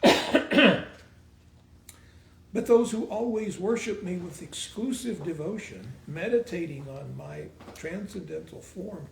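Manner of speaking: reciting scripture with a booming start; grand declaration of the verse; voicing Krishna. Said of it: "He says".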